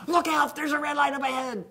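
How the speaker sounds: a passenger shouting a warning about a red light. Retold or imitated. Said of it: "That person in the front seat goes".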